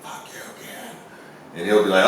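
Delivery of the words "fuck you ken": whispered curse from nowhere; ghostly whisper. Retold or imitated. Ghost cursing Ken on the recording, in a whisper. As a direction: hiss it softly like a ghost.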